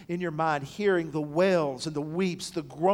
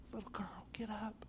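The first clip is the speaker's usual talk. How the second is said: put-on breathy whisper of "Little girl, get up"; 5 semitones higher, 16 dB quieter than usual. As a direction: whisper it like a secret into her ear. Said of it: "he whispers in her ear".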